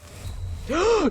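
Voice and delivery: high shocked intake of breath; sharp gasp. Real, not acted. Gamer gasping as enemies swarm, shocked.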